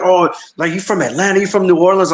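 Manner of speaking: curious questioning. Retold or imitated. Retells people guessing his origin.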